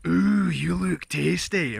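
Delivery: slow, drawn-out greedy glutton voice; Fat Bastard impression. Asked for Fat Bastard, answering in his slow glutton voice. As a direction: ham up the hungry villain, slowly. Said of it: "can you do Fat Bastard impressions?".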